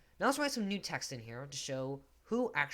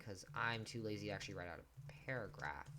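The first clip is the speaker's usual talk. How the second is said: ordinary talk, nothing marked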